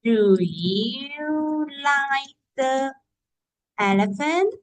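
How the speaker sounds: teacher showing pupils how to ask Ben; slow model question. Shown as a demonstration. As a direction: stretch each word as a classroom example. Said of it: "you can ask Ben".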